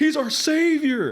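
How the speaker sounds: high, loud defiant yell; rally the crowd against the soldiers; defiant mob shout. Retold or imitated. Soldier yelling at the general to defend Superman, high and loud.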